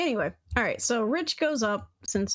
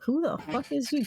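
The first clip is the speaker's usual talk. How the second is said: disgusted; 6 semitones higher than usual. Pretend. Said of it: "looking at you with disgust".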